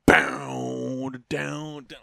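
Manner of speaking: spitting into the mic on the words; spraying spit while saying it; spit take. Real, not acted. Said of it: "I just spit all over the mic".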